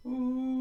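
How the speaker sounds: one long breath of ooh sliding up and down; swooping, wobbling "ooh". Shown as a demonstration. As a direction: trace hills with a gliding ooh. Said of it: "I will do the voice sounds".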